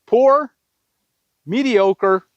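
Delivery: ordinary talk, nothing marked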